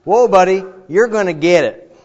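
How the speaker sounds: high and loud mocking threat. Preacher imitating a gloating judge, loud mock threat. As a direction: warn them they're in for it.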